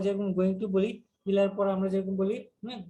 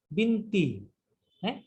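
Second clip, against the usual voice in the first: ordinary talk, nothing marked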